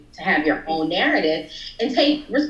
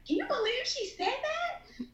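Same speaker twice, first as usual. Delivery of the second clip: very high, hushed incredulous squeal; scandalized, telling mom the gossip; softly squeaked mock outrage; retold disbelief; 10 dB quieter than usual. Retelling outrage told to mom.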